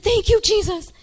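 high, loud retold cry of relief; hammed-up grateful shout; elated thanks. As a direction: praise Jesus with exaggerated relief. Retold relief when car stalls.

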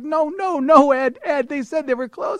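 high, pleading objection; flustered protest. Retold or imitated. Man dragged to the front by his friend, high flustered protest.

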